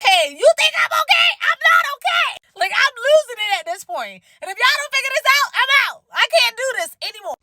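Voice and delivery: high, shouted mock breakdown; hammed-up panic, losing it; frantic outburst. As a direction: VP admitting he's overwhelmed, high frantic shout. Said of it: "he's like".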